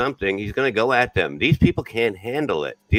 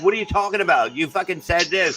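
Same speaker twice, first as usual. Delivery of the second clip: acted indignation, pitched high; worked-up calling-out; 8 semitones higher than usual. Calling someone out on what they said, mock indignant. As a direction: challenge them in a high, heated voice. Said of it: "That's why he gets like that".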